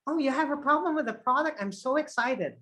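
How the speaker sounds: high, quick, gushing excitement; inappropriately thrilled agent, pitched high; wrongly excited. Shown as a demonstration. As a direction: react to a complaint as if delighted. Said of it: "My response is not".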